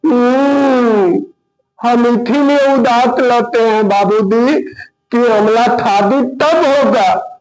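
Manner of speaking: high, slow lisping sulk; lisping son voice. Acted. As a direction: sad lisping reply. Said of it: "तोतला कहता है". (तुतलाते बेटे की उदास आवाज़)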